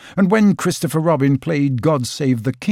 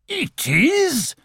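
much higher, a burst of triumph; delighted discovery. Acted. Owl sure at last of the find, high and triumphant. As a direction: crow it out, he's found it. Owl confirms what he sees.

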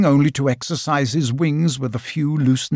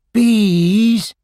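much higher and louder, calling out; calling cry; 9 semitones higher, 5 dB louder than usual. Acted. Pooh calling to the bees to come home, loud call. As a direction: call them home across the bush. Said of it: "cried Pooh".

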